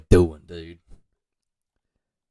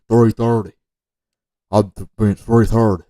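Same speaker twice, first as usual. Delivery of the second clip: louder mock lifter's answer; imitated player's talk; 8 dB louder than usual. Coach imitating how the player talks, loud mock answer. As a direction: lightly put on a player stating his bench. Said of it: "that's how you talk, obviously".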